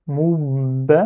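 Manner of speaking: "moub" as "moubba", lips held shut on the b; doubled b. Shown as a demonstration. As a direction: hold the b of "moub" before opening on "ba". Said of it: "je le fais pas parce que".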